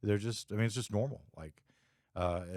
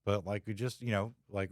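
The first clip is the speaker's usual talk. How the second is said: usual speaking voice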